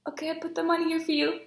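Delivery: high, flustered little voice. Retold or imitated. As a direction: imitate yourself awkwardly handing something over. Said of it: "I get, like, so flustered".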